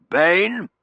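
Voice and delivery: blustering master's shout; commanding colonel. A narrator's reading. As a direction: call sharply for the butler. Colonel calling the butler.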